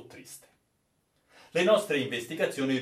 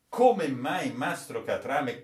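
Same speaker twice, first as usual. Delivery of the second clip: usual speaking voice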